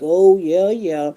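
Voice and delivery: bright mock "oh yeah"; mock agreeable. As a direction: pretend to go along happily. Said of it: "you can act like".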